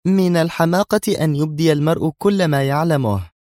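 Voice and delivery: high-pitched, irritated comeback; nervy retort. A narrator's reading. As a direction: answer back on edge. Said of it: "أجابتها نادية في عصبية". (بعصبية)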